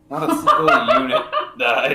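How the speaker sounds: loud, laughing through the correction. Real, not acted. Gamer corrects his own boast, laughing loudly. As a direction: crack up mid-sentence on the word single. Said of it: "Sorry, three hundred and twenty peasants died".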